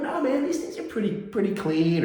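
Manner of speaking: lightly voiced laid-back brush-off; casual brush-off. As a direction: wave the salesman off breezily. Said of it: "I'd have been like".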